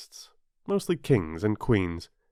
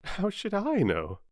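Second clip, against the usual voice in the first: higher-pitched pert retort; bold Alice; 10 semitones higher than usual. A narrator's reading. Alice surprised at her own courage, bold higher voice. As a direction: answer back boldly, pitched up. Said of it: "surprised at her own courage".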